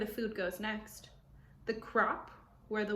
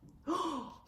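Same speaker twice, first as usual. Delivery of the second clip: gasp; 7 semitones higher than usual. Acted. Reader reacts to picture.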